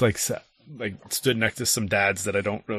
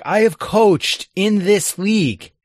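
retold indignation, high and slow; indignant retort; 8 semitones higher, 5 dB louder, slower than usual. Coach protesting to the league, indignant. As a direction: push each word out in offended protest. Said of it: "the thing that set me off".

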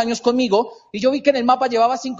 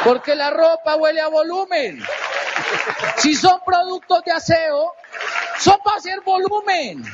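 emphatic shout; 5 semitones higher than usual. Real, not acted. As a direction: hammer home the word volumen. Punchline shouted to the audience.

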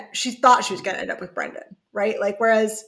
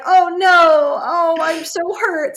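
mock hurt; 6 semitones higher, 4 dB louder than usual. Chris voiced mocking his hurt.

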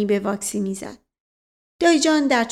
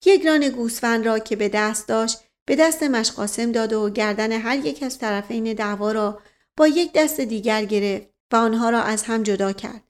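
plain reading voice

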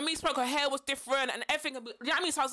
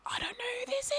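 whispered aside; 10 semitones higher, 4 dB quieter than usual. Retold or imitated. Private thought during hug.

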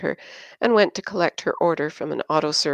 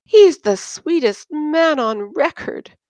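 much higher, slow tearful gush; 9 semitones higher, 4 dB louder than usual. Acted. Christine defends her boyfriend to Yin, tearful gush. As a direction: gush through welling tears.

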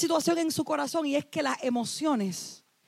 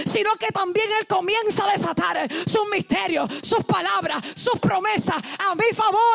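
raised, near-sung preacher voice; fervent preaching; 4 semitones higher than usual. Real, not acted. Preacher to congregation, fervent rising proclamation. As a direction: lift the congregation with fervor.

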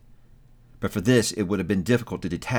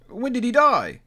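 much higher, curious voice; second merchant; 12 semitones higher, 3 dB louder than usual. A narrator's reading. Another merchant asking curiously. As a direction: ask it lightly. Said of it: "inquired another".

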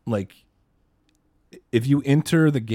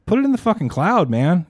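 high, heated exasperation; exasperated scolding; 7 semitones higher, 4 dB louder than usual. Pretend. Host berating the reckless game designer, high exasperated scolding. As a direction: scold as if it's blindingly obvious.

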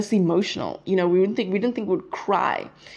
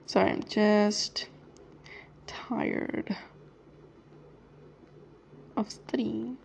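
soft, drawn-out words with long gaps; typing aloud; 9 dB quieter, much slower than usual. Real, not acted. Excusing herself to someone while texting, typing aloud. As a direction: absently voice a text as you write it.

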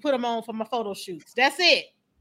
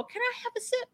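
put-on little girl asking; 10 semitones higher, 7 dB quieter, faster than usual. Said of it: "I would sit in his lap and go".

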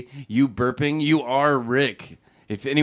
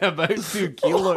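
higher, laughing mock-Scottish accent; Scottish film quote; 7 semitones higher than usual. Host retells her keloid joke, mock-Scottish accent. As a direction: repeat the Scottish line, pitched up. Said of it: "and I said".

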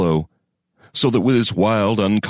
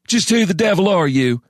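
indignant man voice; 12 semitones higher than usual. A narrator's reading. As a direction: challenge the intruder hotly. Crane confronts the stranger.

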